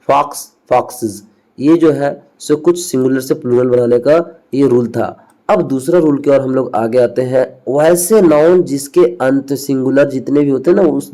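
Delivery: normal talking voice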